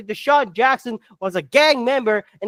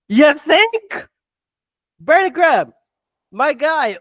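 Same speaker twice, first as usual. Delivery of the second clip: higher, louder mock disbelief; sarcastic retort; 4 semitones higher, 5 dB louder than usual. Fan answering a player's backtracking, sarcastic. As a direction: throw it back at him, incredulous.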